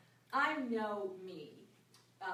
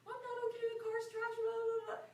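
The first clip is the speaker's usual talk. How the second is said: much higher mock panicky teenage voice; hammed-up upset stepdaughter; 4 dB louder than usual. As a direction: squeak it out like a frantic sixteen-year-old. Stepdaughter voiced in retold scene.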